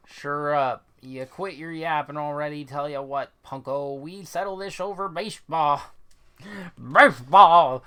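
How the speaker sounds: put-on slurred drunk challenge building to a loud blurt; hammed-up drunken defiance; belligerent drunk ballplayer. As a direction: slur it like a tipsy man picking a fight. Voices drunk game character.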